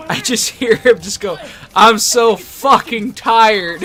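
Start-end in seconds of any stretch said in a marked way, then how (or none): 1.7-3.8 drawn-out, loud groan of exhaustion; retold exhausted shout; exhausted yell. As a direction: yell it slow like you're spent. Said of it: "That's what he yelled out".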